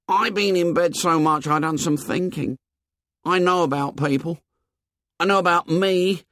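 put-on high, earnest boy's voice; high-pitched, worried insistence; Piggy explaining anxiously. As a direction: reason it out in a high boyish voice.

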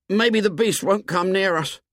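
put-on high, timid boy's voice; a nervous, bespectacled boy pleading; Piggy voice. A narrator's reading. Piggy's voice in the story.

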